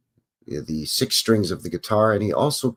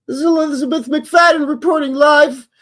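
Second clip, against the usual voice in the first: high, loud TV reporter voice, live on air; crazy weather reporter; 8 dB louder than usual. Retold or imitated. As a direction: ham it up like a reporter in a hurricane. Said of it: "your crazy weather reporter".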